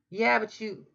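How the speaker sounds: ordinary delivery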